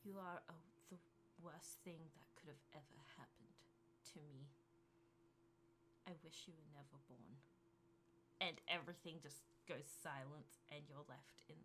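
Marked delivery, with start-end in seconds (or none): 0.0-7.4 soft, cold, much quieter curse; cold hushed curse. Acted. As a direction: whisper the curse from the dark. Final cruel voice in darkness.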